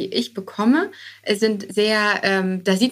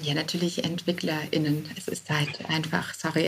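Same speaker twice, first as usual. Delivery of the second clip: much softer, sheepish admission; 4 semitones lower, 8 dB quieter than usual. Real, not acted. Guest sheepishly admitting they need developers, soft. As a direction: drop the voice, half embarrassed. Apologetic obvious answer.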